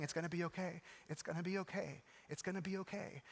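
retold reassurance, softly repeated; soft, steady comforting father; soothing repetition. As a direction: keep murmuring comfort to an injured child. Father soothing daughter as paramedics work.

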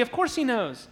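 ordinary delivery

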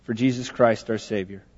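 soft, low prayer voice; closing prayer. Acted. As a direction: gently close the prayer for the congregation.

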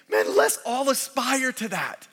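high, slow, heartfelt exhortation; stretched-out, aspiring appeal; earnest rallying. Acted. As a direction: urge everyone on with lifted pitch. Voices the congregation's hoped-for response.

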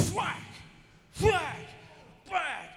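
high, loud whack whack whack; loud mock smacking noises; shouted whack sounds. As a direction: yell each whack like a blow landing. Acting out smacking heads.

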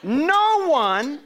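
slow, high, loud preached verse, every word leaned on; preaching at full force. Acted. Pastor pressing the verse on his church, preaching loud. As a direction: lift the verse up to the congregation.

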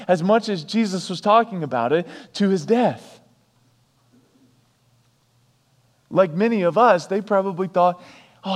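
loud, high, driving sermon delivery; fired-up preacher; full-force preaching. Acted. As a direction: preach it out with building fervour.

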